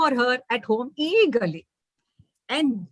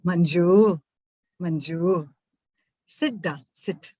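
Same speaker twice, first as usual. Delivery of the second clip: tender mother; 6 semitones lower, slower than usual. Acted. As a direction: settle her daughter down. Mother calming daughter.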